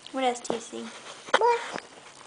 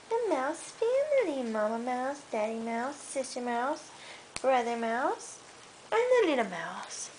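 put-on reading to a child; 6 semitones lower, 3 dB quieter than usual. Mother reading a bedtime book to her toddler, gentle storytelling. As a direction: softly read picture-book storytelling. Reads picture book aloud.

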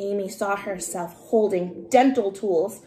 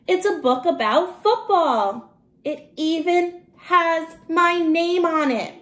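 higher, louder, slowing in delight; thrilled; 8 semitones higher, 4 dB louder than usual. Acted. Matt amazed at his football book, high and delighted. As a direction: marvel at your own name on the book.

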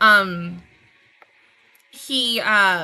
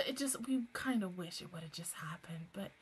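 soft unvoiced whisper, wistful; 5 semitones lower, 18 dB quieter than usual. Real, not acted. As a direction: whisper it like a secret wish. Trailing into whispered wish.

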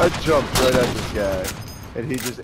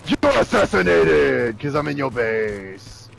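slow, drawn-out put-on victory call; mock taunting; slower than usual. Player crowing over a kill in enemy base, mock taunt. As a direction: stretch the words into a silly gloat.

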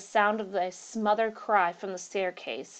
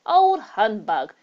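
scornful; 3 semitones higher, 10 dB louder, much slower than usual. Acted. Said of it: "said Hurst".

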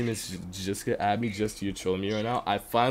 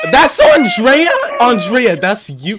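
loud, high disbelief; high outburst of discovery; shocked shout; 13 semitones higher, 12 dB louder, slower than usual. Real, not acted. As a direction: yell the name in astonishment. Shocked to discover who is singing.

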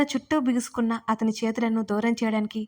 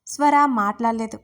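plain reading voice

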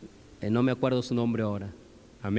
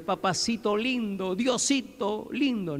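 higher, drawn-out mock-sweet prayer; oración melosa imitada; 6 semitones higher, slower than usual. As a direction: mimic cloying pet names in prayer, slowly. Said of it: "algunos cuando oran dicen". (imitación melosa al orar, aguda y alargada)

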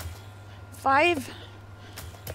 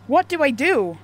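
scared; 5 semitones higher, 6 dB louder than usual. Real, not acted. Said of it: "Oh, I'm scared".

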